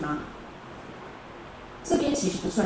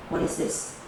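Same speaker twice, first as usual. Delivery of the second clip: ordinary talk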